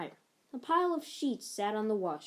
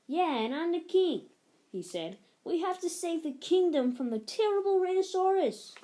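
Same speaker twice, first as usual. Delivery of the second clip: pretend king; 9 semitones higher, 3 dB louder than usual. Child plays king.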